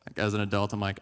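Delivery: usual speaking voice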